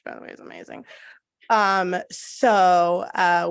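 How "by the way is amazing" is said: whispered aside. Real, not acted. Whispered aside about pastry.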